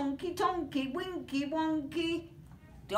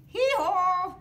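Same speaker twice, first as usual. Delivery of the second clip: loud high hee-haw bray; donkey bray; 7 semitones higher, 7 dB louder than usual. Acted. Reader braying as the donkey, loud and high.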